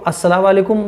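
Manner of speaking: quick "अस्सलाम वालेकुम" merged as one word; mistaken salam. Shown as a demonstration. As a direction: slur "अस्सलाम" straight into "वालेकुम". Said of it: "नहीं करना है". ("अस्सलाम वालेकुम" मिलाकर, जल्दी)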